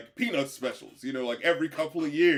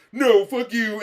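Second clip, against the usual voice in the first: mock angry retort; 7 semitones higher, 8 dB louder, slower than usual. Voicing Jax snapping back.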